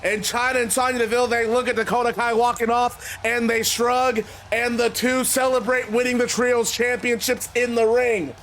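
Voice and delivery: usual speaking voice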